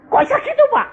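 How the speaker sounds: high, astonished question; astonished landlord. Retold or imitated. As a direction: ask it amazed, pitched well up.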